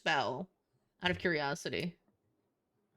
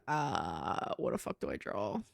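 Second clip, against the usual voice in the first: low confused voice; 13 semitones lower, slower than usual. Retold or imitated. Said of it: "I'm like".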